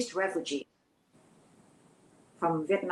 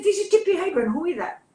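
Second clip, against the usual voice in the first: high voiced actress; 8 semitones higher, faster than usual. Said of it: "She said".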